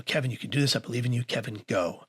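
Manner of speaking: soft, low words to himself; steady yourself with quiet, private encouragement; hushed pep talk. Retold or imitated. Nervous man coaching himself through a first date, hushed.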